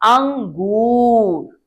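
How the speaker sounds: अंगूर very slow, nasal ng held long; drawn-out demo. Shown as a demonstration. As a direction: stretch अंगूर for the children to hear the ng. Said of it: "क्या शब्द बना?".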